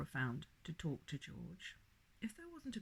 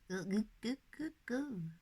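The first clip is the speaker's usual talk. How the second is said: put-on baby babble; 4 semitones higher, 3 dB louder than usual.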